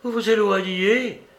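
a touch of theatrical despair; despairing cry. A narrator's reading. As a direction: cry out in dismay as he leaves. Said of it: "s'écria sa femme avec désespoir".

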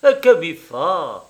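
slow, puzzled shrug in the voice; vague surprise. A narrator's reading. Woman answers which man she prefers, vaguely surprised. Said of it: "répondit avec une vague surprise".

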